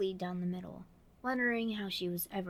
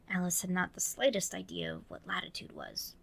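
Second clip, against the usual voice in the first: ordinary delivery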